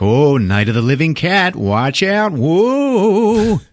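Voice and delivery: hammed-up horror-movie mockery; mocking spooky. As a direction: tease with a ghostly, high final whoa. Friend mocks her undead cat story.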